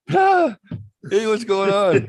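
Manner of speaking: very high, loud, slow fake-cheerful hello; mock cheery greeting. Greeting the same cop again, loud, very high and fake-cheerful.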